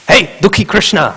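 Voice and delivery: high, loud call across the room; an angry guru summoning his disciple; retold angry call.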